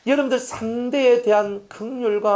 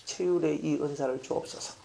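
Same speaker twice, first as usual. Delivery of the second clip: a hushed, humble prayer to God; a gentle murmured plea; soft prayer; 4 semitones lower, 11 dB quieter than usual. Shown as a demonstration. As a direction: pray it quietly, as if alone before God. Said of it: "여러분들이 기도해야 돼요".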